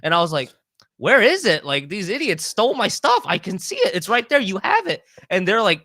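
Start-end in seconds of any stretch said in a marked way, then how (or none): 1.0-5.0 high, loud outraged protest; indignant accusation. Retold or imitated. Traveler to airport security, loud and indignant. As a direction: demand your stolen bag back, riled up.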